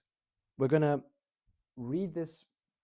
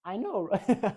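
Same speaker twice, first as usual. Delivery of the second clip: higher and louder, gleeful; loud excited; 7 semitones higher, 8 dB louder, slower than usual. Shown as a demonstration. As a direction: belt it out with glee. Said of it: "I sound like Elmo".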